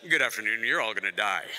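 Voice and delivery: deadpan, very low pilot announcement; airline pilot. Acted. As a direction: deliver doom as a mock captain's routine announcement. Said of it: "if a pilot gets on and he's like".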